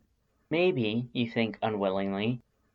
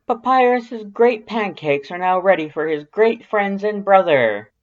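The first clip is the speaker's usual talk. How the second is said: booming, high and much louder proud announcement; bellowing; 9 semitones higher, 9 dB louder than usual. Acted. Papyrus calling everyone to breakfast, proud loud bellow. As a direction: bellow it to the whole house. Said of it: "Papyrus bellows".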